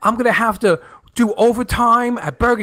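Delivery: high, put-on complaining voice; aggrieved friend. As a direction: imitate a friend griping, pitched well up.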